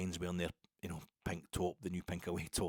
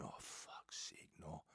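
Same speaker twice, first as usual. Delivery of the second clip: a dismayed whisper under the breath; whispered groan; 3 semitones lower, 16 dB quieter, much slower than usual. Retold or imitated. Young fans whispering their dismay at the big man. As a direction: breathe it out with a slow sinking whisper.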